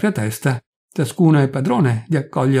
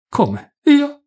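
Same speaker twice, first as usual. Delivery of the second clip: taken aback; 14 semitones higher, 3 dB louder than usual. A narrator's reading. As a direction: jump up in startled disbelief. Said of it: "balbettò il Vocalopulo".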